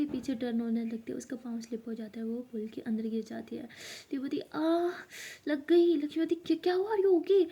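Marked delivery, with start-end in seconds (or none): 4.5-6.0 high, loud yelp of pain; pained cry. Acted. Lili falling into the pool, crying out loud in pain. 6.3-7.4 high, loud startled concern; alarmed. Acted. Lakshmi to Lili in the pool, high alarmed call.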